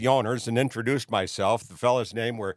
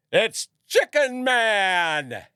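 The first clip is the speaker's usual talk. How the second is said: loud, high, drawn-out announcing holler; bartender's yell; 9 semitones higher, 5 dB louder, much slower than usual. Retold or imitated. Bartender announcing the act to the crowd, yelled out. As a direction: call it out long across a bar. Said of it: "the bartender would yell out".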